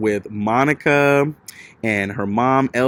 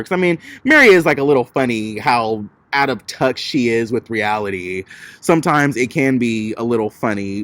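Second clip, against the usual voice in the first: ordinary talk, nothing marked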